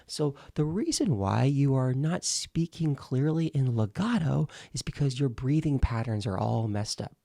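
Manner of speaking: ordinary talk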